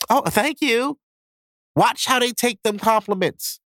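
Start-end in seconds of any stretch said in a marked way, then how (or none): 0.1-1.0 put-on high, coy girl voice; girly thank you. Girl brushing off a compliment, in a high coy voice. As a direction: play the flattered girl, pitched way up.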